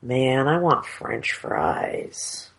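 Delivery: slow, soft longing drawl; craving whine. Retold or imitated. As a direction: moan it like you crave fries. Craving voiced as inner quote.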